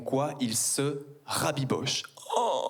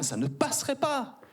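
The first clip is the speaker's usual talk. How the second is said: mock tut-tut; 11 semitones higher than usual. Mock woman's disapproval.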